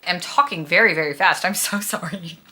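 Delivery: normal talking voice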